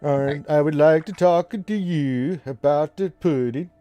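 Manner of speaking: slow, singsong Swedish lilt; Swedish cadence. Shown as a demonstration. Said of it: "Swedish voice is more of a cadence thing".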